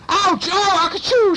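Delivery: very high, loud yelp into sneeze; pained yelp. A narrator's reading. Cat claws the burglar's leg, pained sneezy yelp. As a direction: cry out, then sneeze, hammed-up.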